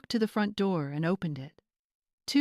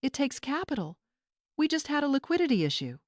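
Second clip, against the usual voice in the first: defensive, rushed; 8 semitones higher, faster than usual. A narrator's reading. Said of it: "Tara cried".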